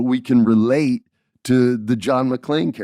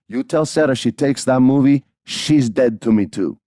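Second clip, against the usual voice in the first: put-on higher, louder ultimatum in Puerto Rican accent; upset grandma; 3 semitones higher, 4 dB louder than usual. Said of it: "she's pretty upset".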